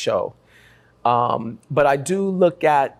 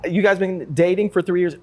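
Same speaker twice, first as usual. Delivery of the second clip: ordinary talk, nothing marked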